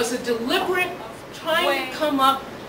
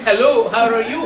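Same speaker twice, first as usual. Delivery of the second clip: put-on lower, louder greeting in a thick Spanish accent; deep Spanish accent; 3 semitones lower, 5 dB louder than usual. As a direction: imitate a father's deep Spanish accent saying hello. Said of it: "deep Spanish accent".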